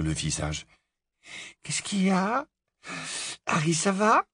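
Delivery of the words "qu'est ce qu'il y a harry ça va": voix aiguë et légère de jeune femme réveillée; higher, worried questioning on waking; inquiète au réveil. Acted. Hermione tirée du sommeil, inquiète. (voix haute, inquiète, au réveil)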